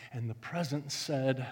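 usual speaking voice

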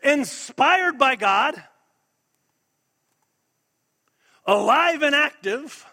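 loud, high, slow declaration; forceful preaching. Real, not acted. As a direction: proclaim each phrase with weight and pauses. Preacher makes a forceful, spaced declaration.

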